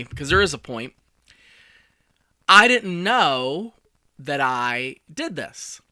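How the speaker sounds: ordinary delivery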